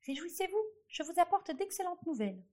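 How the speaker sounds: high squeaky mouse voice, bright and eager; little mouse calling out. A narrator's reading. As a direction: squeak out good news like a tiny mouse. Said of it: "La petite souris lui cria".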